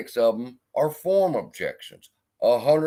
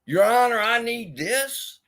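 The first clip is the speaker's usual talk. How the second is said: mock pleading; 9 semitones higher than usual. Mimicking the streamer begging the judge, high mocking whine. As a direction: whine it up high like a fake plea. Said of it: "you gonna ask for a favor".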